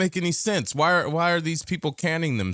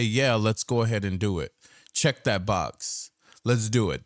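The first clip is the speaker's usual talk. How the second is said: lower, lazy mock voice of a borrower; mock careless borrower; 7 semitones lower than usual. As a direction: drop low and play the fool signing up. Mocking someone's bad choice.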